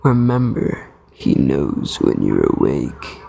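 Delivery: very low, slow, ominous mock mom voice. Reactor mocks the creepy mom's bedtime warning, low and slow. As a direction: drag it out deep like a spooky parent. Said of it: "she was like".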